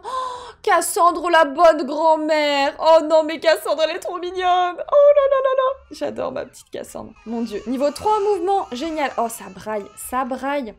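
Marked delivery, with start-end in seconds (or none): 0.0-5.8 high, loud and drawn-out cooing over Cassandre; gushing. Real, not acted. Player gushing at her game grandmother, high delighted coo. 5.9-7.1 tender. Real, not acted. Tender over grandma.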